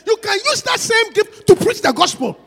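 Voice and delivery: slow, loud preacher's call; preaching shout. Real, not acted. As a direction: proclaim it to the whole church. Preacher exhorting congregation.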